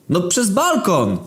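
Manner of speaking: high put-on voice; high, silly character voice; Gruby's voice. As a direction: play the dim friend in a high voice.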